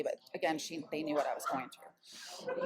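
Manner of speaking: normal talking voice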